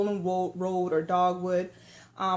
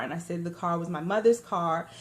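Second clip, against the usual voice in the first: ordinary talk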